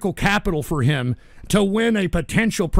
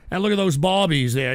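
ordinary delivery

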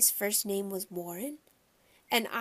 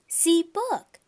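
put-on high, loud little-kid exclamation; small boy demanding attention; insistent toddler; 8 semitones higher, 8 dB louder than usual. A narrator's reading. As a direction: crow it like a proud small child. Toddler voiced in story.

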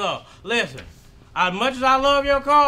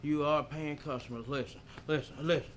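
calming down; 9 semitones lower, 15 dB quieter than usual. Acted. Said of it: "let me calm down".

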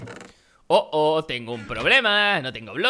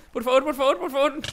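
pleading; 9 semitones higher than usual. Real, not acted. Still in danger, pleading.

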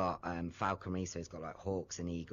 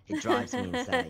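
high, loud exasperated cry; exasperated outburst; 7 dB louder than usual. Real, not acted. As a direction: blow up at the Christmas hype. Ranting about Christmas hype.

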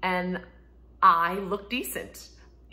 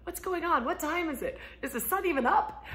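higher, bewildered questions; confused mom just woken; groggy startle; 9 semitones higher than usual. Retold or imitated. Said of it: "I'm like".

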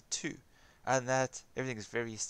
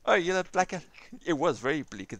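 usual speaking voice

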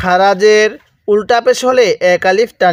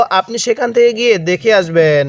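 normal talking voice

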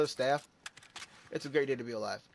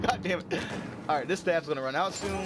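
ordinary talk

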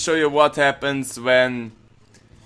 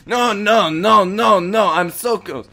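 panicked; 6 semitones higher than usual. Pretend. Player attacked so close to the goal, panicked. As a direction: protest urgently, voice pitched up.

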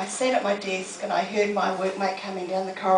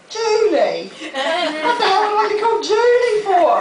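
high, loud scoffing disbelief; incredulous son; 11 semitones higher, 8 dB louder than usual. Retold or imitated. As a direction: scoff at the very idea. Son mocking mum's name.

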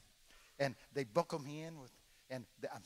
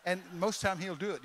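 ordinary delivery

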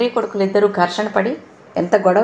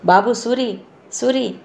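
tender calling; 2 semitones higher, slower than usual. A narrator's reading. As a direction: gently coax the hurt boy awake. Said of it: "చాలా లాలనగా పిలుస్తూ".

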